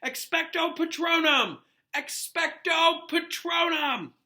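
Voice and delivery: high, very slow, loud bellow; boy bellowing the spell at the Dementor. A narrator's reading. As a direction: bellow the charm out. Repeated spell casting.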